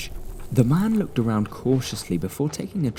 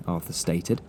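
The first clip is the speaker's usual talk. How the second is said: ordinary delivery, nothing marked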